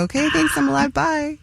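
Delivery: high-pitched mock-polite farewell; imitating a sheepish, high little goodbye; awkward goodbye. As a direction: pitch it up like an awkward morning-after exit. Host acts out an awkward exit.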